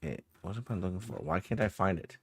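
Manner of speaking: muttering to himself, annoyed; frustrated muttering. Real, not acted. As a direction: grumble under your breath while searching. GM searching for lost item.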